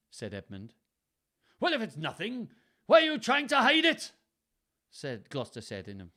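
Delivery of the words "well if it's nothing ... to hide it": very high, loud, hard-to-place Welsh; wobbly Welsh accent. A narrator's reading. Father challenges his son over hidden paper, shaky Welsh accent. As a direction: demand it in a hammed-up, uncertain Welsh accent. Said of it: "accent that's very difficult to pin down".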